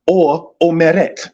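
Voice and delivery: omeret said slowly and carefully, stress on the last syllable; careful teacher pronunciation; Hebrew word said slowly. Shown as a demonstration. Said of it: "The present tense of such is the word omer".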